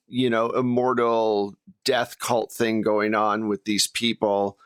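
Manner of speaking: normal talking voice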